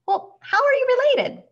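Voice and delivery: very high, loud incredulity; baffled question. A narrator's reading. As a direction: clerk blurts it out in a squeak. Flustered clerk questions crocodile's owner.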